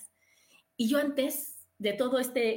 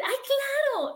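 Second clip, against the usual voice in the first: fake eager yes; 13 semitones higher, 4 dB louder, slower than usual. Fake eager reply to invitation.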